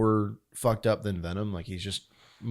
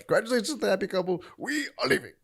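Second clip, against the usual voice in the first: high, fast, hammed-up voice; Venom; 12 semitones higher, faster than usual. Host acting out the happy couple moment, high rushed impression. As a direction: rattle it off high, laughter creeping in. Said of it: "and he's like".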